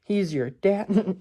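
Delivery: playful disbelief, drawn out; mock shock. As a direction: act floored by the twist. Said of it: "And it's like".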